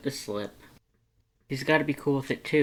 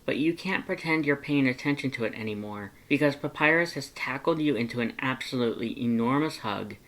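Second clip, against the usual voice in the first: plain reading voice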